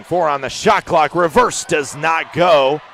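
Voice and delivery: urgent courtside shot call; excited play-by-play. Real, not acted. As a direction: punch it out, stretching the last words. Live call of shot attempt.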